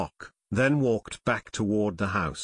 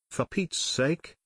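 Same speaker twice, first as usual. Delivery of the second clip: slightly higher, drawn-out startled exclamation; 4 semitones higher, slower than usual. A narrator's reading. Brother suddenly spots something startling, a mild startled exclamation. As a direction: exclaim with mild, held-in astonishment. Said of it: "Joe exclaimed".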